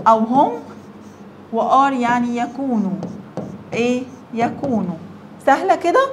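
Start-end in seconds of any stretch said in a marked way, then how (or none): none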